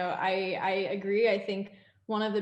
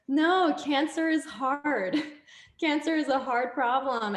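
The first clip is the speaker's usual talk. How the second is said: slow, higher, louder emphasis; dad insisting the problem is hard; firm insistence; 6 semitones higher, 4 dB louder, slower than usual. Retold or imitated. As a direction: lean on every word, slowly. Dad insisting on late call.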